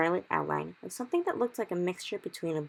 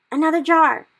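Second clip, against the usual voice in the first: much higher, loud excited cry; cried; 12 semitones higher, 16 dB louder than usual. Acted. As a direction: shout with thrilled urgency. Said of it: "he cried".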